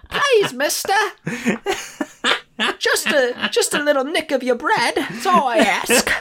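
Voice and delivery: very high, pleading Victorian urchin; street urchin voice. Retold or imitated. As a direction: beg for bread like a street urchin. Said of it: "really high-pitched ... fake".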